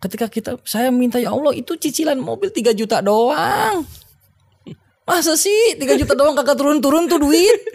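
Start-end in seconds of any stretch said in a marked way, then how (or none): 1.2-7.6 rising, exasperated plea; complaining prayer. Retold or imitated. Retelling his prayer for the car payment, rising exasperation. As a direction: whine at God, voice climbing higher.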